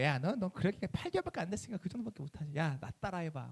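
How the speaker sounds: lightly acted showing off to a beginner; mock swagger. Senior church member belittling a newcomer, mildly swaggering. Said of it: "막 이러면서 막 거들먹거려".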